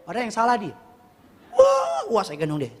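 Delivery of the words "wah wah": very high mock wailing; imitating child crying. Hurt child crying to his father, high mock wail. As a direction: wail like a small hurt kid.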